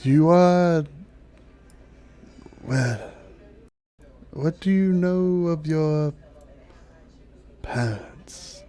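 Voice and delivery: slow and halting, fading to a breath; hesitant dryad. Acted. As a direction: feel out the delicate question, unhurried. Dryad hesitantly asks about parents.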